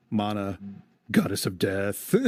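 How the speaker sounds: normal talking voice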